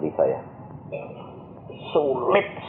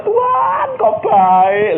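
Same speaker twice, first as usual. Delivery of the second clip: high, loud mock cry to God; acted-out overwhelmed exclamation; amazed cry; 7 dB louder, much slower than usual. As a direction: call out in exaggerated wonder. Maid's retold cry to God.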